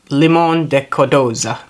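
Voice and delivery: put-on Italian accent; Italian-accented flourish on the name. Real, not acted. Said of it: "said that in an Italian accent".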